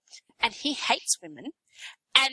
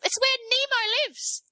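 indignant high emphasis; 13 semitones higher than usual. Real, not acted. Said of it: "stressing the reef's value".

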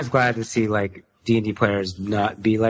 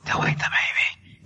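quiet at night. Real, not acted. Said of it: "we don't wanna wake up Ella".

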